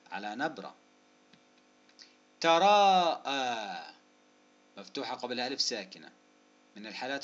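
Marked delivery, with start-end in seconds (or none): 2.4-3.9 "تراءى" loud, with very long vowels; very slow "تراءى"; syllable-by-syllable. Shown as a demonstration. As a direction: stretch both vowels of "تراءى" around the hamza. Sounding out word for spelling.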